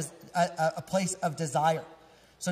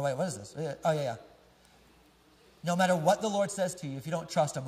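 usual speaking voice

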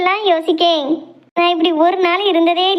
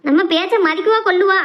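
ordinary talk

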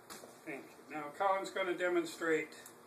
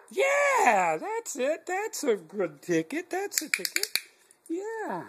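excited praise; 11 semitones higher, slower than usual. Acted. Praising dog's bark.

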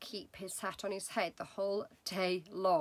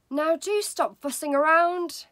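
higher, loud impatient wife; wife's voice; 8 semitones higher, 10 dB louder than usual. Acted. Wife scolding restless husband in bed, loud and impatient. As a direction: tell him off for fussing. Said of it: "his wife said to him".

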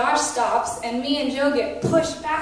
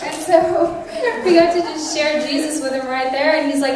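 usual speaking voice

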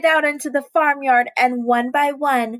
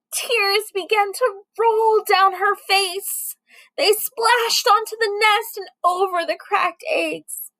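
plain reading voice

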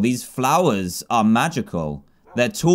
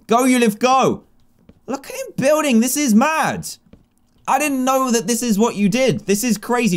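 excited; 9 semitones higher than usual. Real, not acted. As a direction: cheer him on, thrilled. Cheers villager building.

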